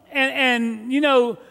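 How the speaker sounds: ordinary talk, nothing marked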